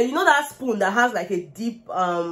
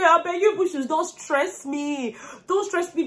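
high, half-laughing, half-crying plea; laughing exasperated plea; 6 semitones higher, slower than usual. Retold or imitated. Vlogger begs the fighting couple to stop, laughing and half crying. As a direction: beg them to stop while laughing.

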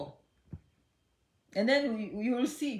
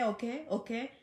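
ordinary talk

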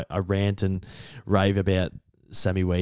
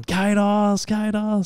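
high, drawn-out mock hail; players calling out; 11 semitones higher, much slower than usual. Players walking past the phone, calling out excitedly. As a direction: call the nickname like passing teammates.

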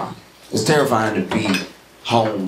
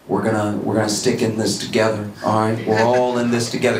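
low, reassuring official voice; mock a governor calming the state on TV; low, soothing governor TV address; 4 semitones lower than usual. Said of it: "the governor would come on T V and be like".

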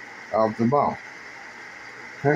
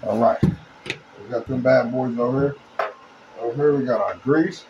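normal talking voice

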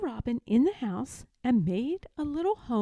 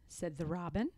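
ordinary delivery, nothing marked

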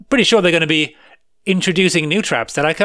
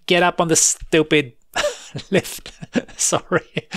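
ordinary delivery

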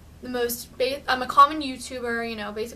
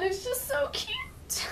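excited squeal; 13 semitones higher, 6 dB quieter, slower than usual. Real, not acted. Gushing over a cute skirt.

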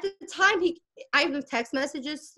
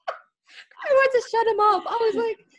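laughing; 11 semitones higher, 4 dB louder than usual. Real, not acted. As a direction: giggle through the admission. Laughing confession.

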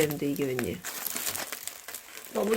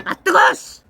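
sharp pained cry at scalding coffee; burned yelp; 9 semitones higher, 6 dB louder, slower than usual. Real, not acted.

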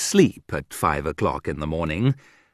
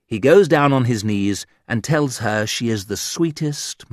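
plain reading voice

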